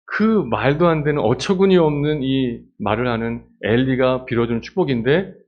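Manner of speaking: normal talking voice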